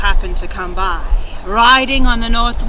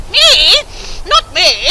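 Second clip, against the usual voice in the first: much higher, spoiled and whiny; whiny stepsister; 12 semitones higher, 3 dB louder than usual. A narrator's reading. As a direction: whine like a spoiled brat.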